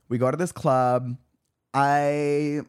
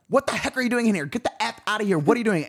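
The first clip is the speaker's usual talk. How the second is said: higher-pitched retold bouncer scolding; bouncer's voice; 8 semitones higher, faster than usual. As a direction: imitate an indignant guy ordering someone out. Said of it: "a guy's voice in my ear".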